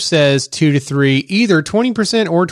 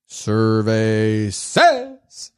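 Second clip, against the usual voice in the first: game show announcer; 7 semitones lower, 4 dB quieter, much slower than usual. Acted. Game show segment intro.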